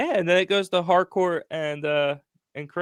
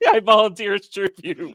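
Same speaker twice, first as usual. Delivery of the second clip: laughing, playfully offering himself up; laughing mock volunteer; 6 semitones higher than usual. Real, not acted. Friend volunteers to be tased, laughing. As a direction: jokingly step forward as tribute, laughing.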